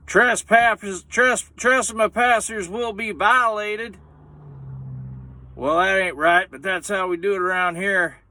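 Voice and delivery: high, slow hillbilly warning; a hint of a menacing backwoodsman. Scaring yuppies off the hunting spot, mock hillbilly threat. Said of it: "then we said".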